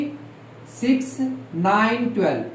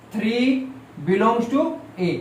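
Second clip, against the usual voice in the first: slow dictation; 3 dB quieter, slower than usual. Shown as a demonstration. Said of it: "यहां पर बोलना".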